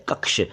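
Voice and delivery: कक्ष said as "kaks"; plain s ending on कक्ष; mispronounced कक्ष. Shown as a demonstration. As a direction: turn कक्ष into कक्स. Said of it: "कक्ष भी नहीं".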